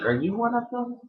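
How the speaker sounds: grandma's high incredulous question. Retold or imitated. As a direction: squeak it like a suspicious grandma. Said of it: "she was like".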